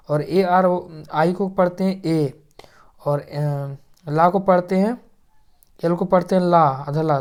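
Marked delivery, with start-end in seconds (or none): none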